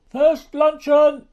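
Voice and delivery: a train attendant announcing the meal; calling out. Acted. A dining-car attendant calling passengers to lunch, loud.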